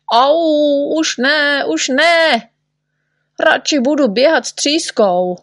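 high, wailing protest; acted high yelps of refusal; pained pleading. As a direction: beg the doctor to stop. Patient begs doctor to stop.

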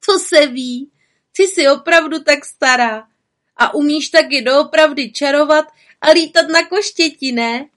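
put-on loud high teasing child; teasing masked child. A narrator's reading. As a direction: egg her on playfully. Mask child teasing louder.

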